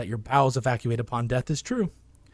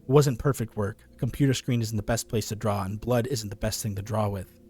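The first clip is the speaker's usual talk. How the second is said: ordinary delivery, nothing marked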